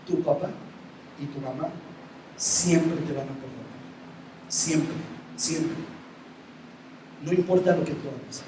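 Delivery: suave, pausado, más bajo; consejo tierno. Retold or imitated. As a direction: habla despacio y con ternura al hijo. Padre aconseja al hijo. (padre enseña al hijo con ternura suave)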